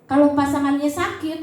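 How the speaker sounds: ordinary delivery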